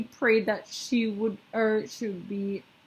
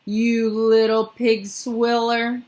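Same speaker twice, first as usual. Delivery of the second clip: loud slow furious roar; angry roar; 3 semitones higher, 8 dB louder, slower than usual. A narrator's reading. Bloodbottler roars insults at the BFG, furious. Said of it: "roared the bottler".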